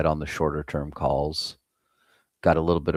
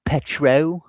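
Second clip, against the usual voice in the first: high mock-British rise; 11 semitones higher, 6 dB louder than usual. Streamer mimicking how a British friend says petrol, mock British accent. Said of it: "need Swazzle up here to say Petro".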